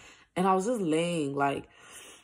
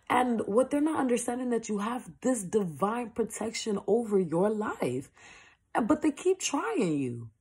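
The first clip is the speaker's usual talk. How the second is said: higher, louder, fervent preaching; preaching at full force; 7 semitones higher, 3 dB louder than usual. Acted. To viewers about enemies who hate them, fervent preaching. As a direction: preach it with mounting fire.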